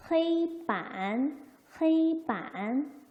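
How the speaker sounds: slow 헤이반, 헤이 held high and level; pronunciation demo. Shown as a demonstration. Teacher models blackboard in Chinese, slow tone demo. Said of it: "A라고 읽으니까".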